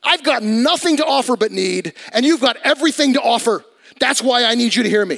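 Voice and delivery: high, urgent pulpit plea; impassioned preaching plea. Acted. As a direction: plead it out from the pulpit, pitch lifted.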